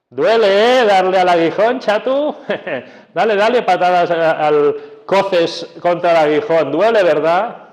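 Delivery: loud, mocking, high teasing; casi cínico. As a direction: taunt him like a jeering friend. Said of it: "casi es cinismo". (Jesús se burla de Saulo, cínico y fuerte)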